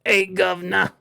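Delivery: hammed-up British geezer; mock British cheeky chap; British accent. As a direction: put on a bad British accent. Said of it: "do a British accent".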